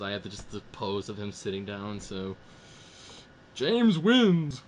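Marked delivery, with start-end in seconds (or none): 3.5-4.6 loud, high winner announcement; announcer shout. Real, not acted. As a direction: call the winner out like a game host.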